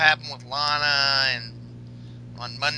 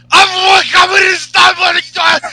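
furious shout, much higher and louder; Rusev shouting; 14 semitones higher, 14 dB louder than usual. Retold or imitated. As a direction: scream it like a raging wrestler. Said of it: "Rusev is like".

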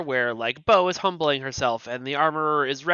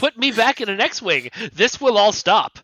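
sassy droid voice; 7 semitones higher, 4 dB louder than usual. Pretend. Voicing the droid's demand.

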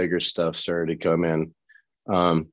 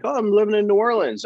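put-on high, quick Southern accent; retold Southern accent, lifted in pitch; natural Southern accent; 11 semitones higher, faster than usual. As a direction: imitate a cheerful-pitched Southern local, fast. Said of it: "he's got this natural Southern accent".

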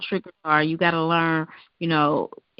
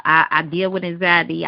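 ordinary talk

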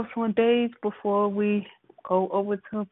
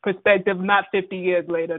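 normal talking voice

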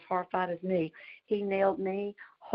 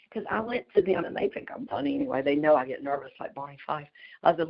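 ordinary delivery

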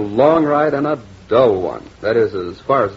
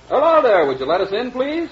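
calling out; 10 semitones higher than usual. Acted. Calling out to the dark house.

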